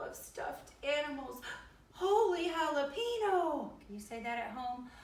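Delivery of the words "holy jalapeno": exclaiming. A narrator's reading. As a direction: burst out in big playful astonishment. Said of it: "exclaimed Skippy John Jones".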